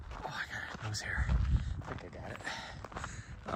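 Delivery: soft slow whisper to self; whispered aside. Real, not acted. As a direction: mutter it under your breath. Aside while pulling nose hair.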